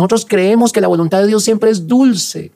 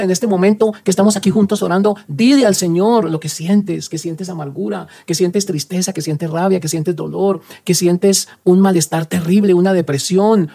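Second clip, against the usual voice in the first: ordinary delivery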